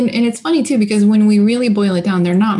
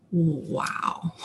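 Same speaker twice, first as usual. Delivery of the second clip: soft, low imitation of awe; hushed awe; 3 semitones lower, 11 dB quieter than usual. Clients amazed at who they have become, hushed awe. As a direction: voice an awed, hushed reaction. Clients' awed realisation, voiced.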